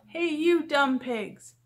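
loud, high, drawn-out call; storybook yell. A narrator's reading. Megan calling to the pigs in the house, loud drawn-out yell. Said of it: "Megan yelled".

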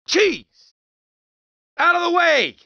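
high, loud startled yell; shouting. Acted. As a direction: bark it at the crowd. Cabbie yelling at street.

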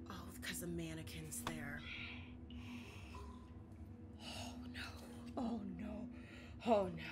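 hushed, breathy worried whisper. Real, not acted. Player hiding from a moving mannequin, whispering. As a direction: whisper it so nothing hears you. Tense moment in horror game.

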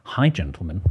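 ordinary talk, nothing marked

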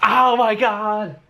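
high, drawn-out fan squeal; ecstatic squealing. Real, not acted. Fan gushing over the Italian queens, squealing slowly. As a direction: start sky-high, stretch the words in delight. Fan reacting as video ends.

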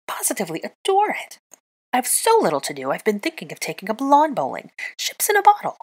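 climbing into a loud, high rant; sarcastic rant rising to a shriek; shrieky sarcasm. Acted. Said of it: "Granger's shriekiness increased".

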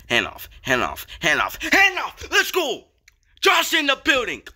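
repeated call that leaps into a high, loud yell; building to hype. Real, not acted. Repeated call bursts into big play.

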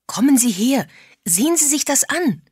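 urgent call, high and loud; excited calling out. A narrator's reading. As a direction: summon him to see your discovery. Excited find, calling James over.

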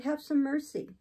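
lifted, urgent entreaty; cried appeal. A narrator's reading. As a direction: beg him for mercy. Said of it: "I cried".

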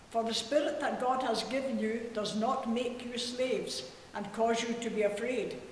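ordinary talk, nothing marked

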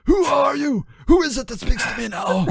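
high-pitched cry of terror; terrified cry. A narrator's reading. Judas backing down the mountain, terrified high cry. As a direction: cry out high, rushing the second question. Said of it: "He cried out in terror".

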